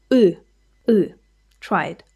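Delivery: ordinary delivery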